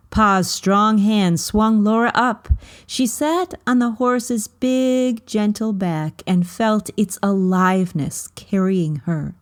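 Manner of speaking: ordinary delivery, nothing marked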